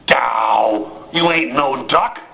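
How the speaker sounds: low, slow moose voice. Acted. Magnificent moose tells the duckling the truth, low slow voice. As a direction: speak like a big, slow moose.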